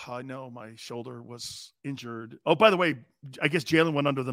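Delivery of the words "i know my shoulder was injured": slow, soft, sulky mock apology; lightly voiced quarterback sulking over his injury; mock Baker Mayfield. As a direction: play the wounded quarterback feeling sorry for himself. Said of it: "Here's my impression of Baker Mayfield".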